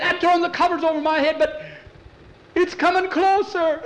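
put-on high, fast scared child; hiding under the covers in panic; frightened boy.

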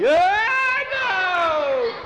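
high, loud wail of refusal; panicked cry after a frightening ride; terrified refusal. Acted. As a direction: yelp it, then wail the no.

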